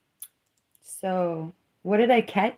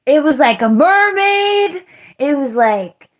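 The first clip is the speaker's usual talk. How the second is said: much higher and louder, swept away; awestruck gushing; 7 semitones higher, 6 dB louder than usual. Real, not acted. Describing the sea lion to viewers, awestruck and much louder. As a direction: rave like you saw a mermaid.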